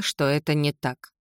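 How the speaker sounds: ordinary delivery, nothing marked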